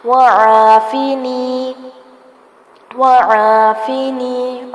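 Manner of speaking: वआफिनी with the long a drawn out; lengthened vowel. Shown as a demonstration. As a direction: stretch the aa in वआफिनी slowly. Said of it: "थोड़ा सा खींच के पढ़ेंगे".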